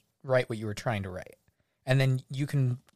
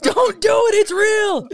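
high, loud, slow begging cry; hammed-up frantic plea; pleading shout; 12 dB louder, slower than usual. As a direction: mock-desperate yell to stop her. Pleading with Selena in a fantasy.

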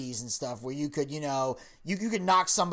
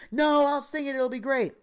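a hint of Roseanne, higher and upbeat; confident wife; 9 semitones higher than usual. Wife insisting she'll sing the anthem, lightly mock confident. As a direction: brush him off, breezily sure of herself. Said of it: "She's like".

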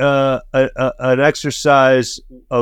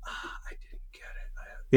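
whisper; 19 dB quieter, slower than usual. Retold or imitated. Cameraman admitting a missed shot.

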